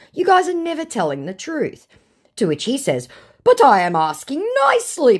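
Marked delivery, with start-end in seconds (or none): 0.1-1.9 high-pitched exasperated rebuke; acted-out indignant accusation; scolding. Own rebuke to scammer, acted. 3.4-5.2 loud, high mock plea; hammed-up begging; pleading scammer.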